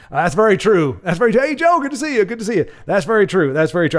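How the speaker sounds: hammed-up folksy politician impression; George W. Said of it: "George Washington impersonation is George W".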